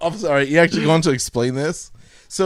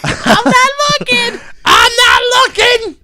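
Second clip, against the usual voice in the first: "I'm not looking" slow, very high singsong; sing-songy; 7 dB louder, slower than usual. A narrator's reading. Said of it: "sing-songy way".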